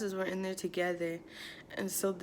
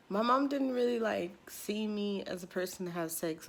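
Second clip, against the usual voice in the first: ordinary talk, nothing marked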